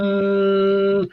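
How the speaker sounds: long, drawn-out boom; sustained hum on one note; imitated bass note. Shown as a demonstration. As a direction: hold the boom like a ringing bass string. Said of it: "'cause you got those deep".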